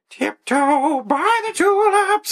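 high, slow falsetto mocking the song title; sing-song the title way up high, drawn out. Mocking the kid's music in a slow falsetto.

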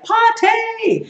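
high, drawn-out party whoop; party cheer. Acted. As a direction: sing out PARTY like a reveler. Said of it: "euphemistically for today, would be like".

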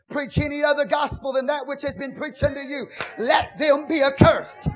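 loud, ringing preacher's cry over the music; full-force preaching. Acted. Preacher urging the church to speak to their souls, loud and fervent. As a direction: whoop it up like a preacher stirring the house.

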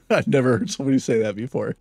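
amused disbelief, chuckling through the words; laughing. Real, not acted. Narrator laughing at the message she reads. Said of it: "I'm laughing at him".

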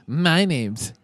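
mocking voice. Mocking annoying customer voice.

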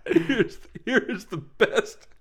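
high, laughing, can barely get it out; laughing while talking. Real, not acted. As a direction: squeeze the words out through laughter. Reader cracking up before best part.

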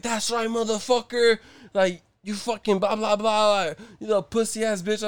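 high-pitched taunting imitation; mock jeering. Retelling the man yelling after him across the street, mock taunt. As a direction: jeer after someone running away. Retold attacker jeering as he flees.

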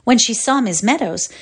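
ordinary delivery, nothing marked

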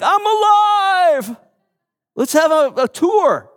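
mock triumphant shout. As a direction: announce it like a proud showman. Imagining boasting about resurrection.

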